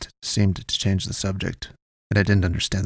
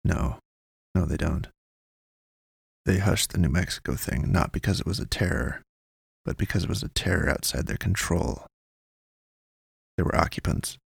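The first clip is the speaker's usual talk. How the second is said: cagey informant; 2 semitones lower, 4 dB quieter than usual. A narrator's reading. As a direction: pass on the tip discreetly, in fragments. Voices informant in story.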